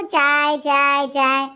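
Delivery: low, monotone chant; mocking repetition. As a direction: mimic the nagging for tea. Wife mocks repeated demands for tea.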